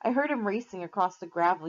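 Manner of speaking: plain reading voice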